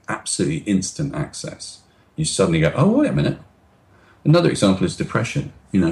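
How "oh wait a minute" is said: sudden realisation. Retold or imitated.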